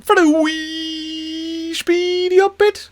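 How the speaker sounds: high, slow, sing-song build-up; playful high drawn-out tease; hammed-up announcement. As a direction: announce the speedy up bit like a showman. Announcing the speed-up.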